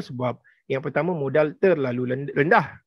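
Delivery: normal talking voice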